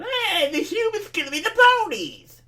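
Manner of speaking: high, loud dopey sneer; shrill loud mockery of commenters; mocking commenter voice. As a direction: mimic a whiny commenter. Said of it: "all these people complaining about".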